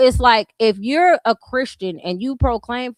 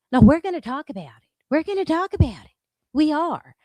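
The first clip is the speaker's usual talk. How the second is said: insistent; 6 semitones higher, 3 dB louder than usual. Real, not acted. Insisting, heated rant.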